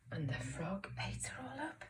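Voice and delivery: dramatic whispered reveal; hushed twist. Shown as a demonstration. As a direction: whisper the shocking ending.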